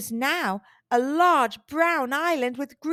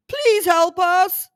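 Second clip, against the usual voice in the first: loud, slow, higher plea; crying out for help; 6 semitones higher, 8 dB louder, slower than usual. Acted. Dragon begs Morton for help, loud pleading cry. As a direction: beg loudly for help. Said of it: "cried the dragon".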